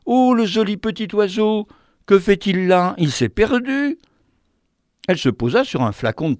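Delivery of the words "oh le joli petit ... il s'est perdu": voix plus aiguë, attendrie et surprise; delighted exclaiming. Acted. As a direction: vendeuses qui s'extasient devant l'oiseau. (les vendeuses s'extasient devant l'oiseau perdu)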